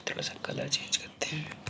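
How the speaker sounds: softly whispered note to herself; whispered aside. Real, not acted. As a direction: murmur it while switching pens. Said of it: "aside while writing".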